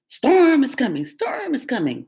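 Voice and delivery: high, loud dog bark fading off; a lightly voiced barking dog. A narrator's reading. Dog barks the warning to the sheep. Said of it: "barked Dog".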